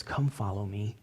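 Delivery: Jesus softly inviting the tax collector; gentle call. Acted. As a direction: quietly invite him to follow. Jesus calling Matthew.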